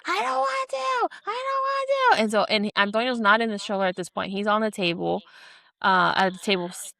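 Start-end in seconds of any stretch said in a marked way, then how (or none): 0.1-2.2 put-on very high, wailing toddler voice; high-pitched kid refusing to drink; screaming toddler. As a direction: wail it like a furious three-year-old. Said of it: "Antonio's screaming".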